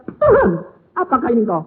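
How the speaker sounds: sudden high, loud cry of realization; woman realizing Jesus is beside her, loud amazed cry; startled recognition. Retold or imitated. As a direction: gasp it out as it dawns on you.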